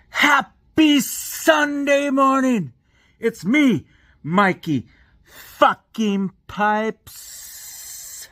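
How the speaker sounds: high, slow, drawn-out mock intro; Mikey Pipes impression. Plumber vlogger parody introducing himself, high and slow. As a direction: stretch out the name like a showy vlogger. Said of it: "a little, uh, Mikey Pipes".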